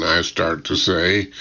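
ordinary delivery, nothing marked

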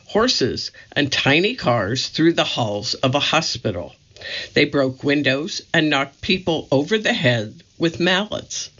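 plain reading voice

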